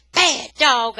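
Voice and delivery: put-on furious boy scolding the dog; angry shout. A narrator's reading. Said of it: "I'm shouting".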